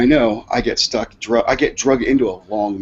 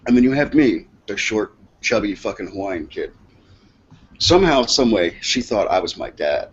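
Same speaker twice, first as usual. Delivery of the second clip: ordinary delivery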